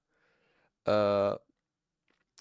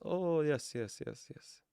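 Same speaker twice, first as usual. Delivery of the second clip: sarcastic yes; 8 dB quieter, slower than usual. Acted. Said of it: "for being overly sarcastic here".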